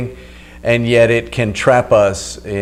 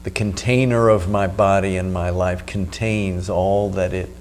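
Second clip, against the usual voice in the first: right in your ear; 3 semitones lower, 7 dB quieter than usual. Acted. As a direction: close and calm, guiding the breath. Leads a breathing meditation.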